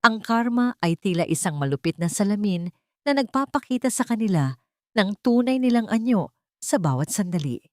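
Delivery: ordinary delivery, nothing marked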